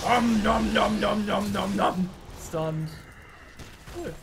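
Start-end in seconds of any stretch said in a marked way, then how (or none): 0.1-2.1 loud, higher playful nom noises; nom nom chomping. Pretend. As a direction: chomp it like gobbling enemies. Gamer mimics eating enemies.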